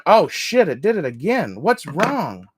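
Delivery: sudden loud startled yell; real yelling. Real, not acted. Crafter yells at a fraying trim, loud and startled. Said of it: "Sorry, I'm yelling".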